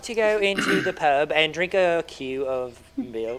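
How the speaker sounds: slow mock British accent drifting into Irish; wobbly British-Irish pub invitation. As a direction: put on a bad British accent, unhurried. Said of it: "[name]'s British accent".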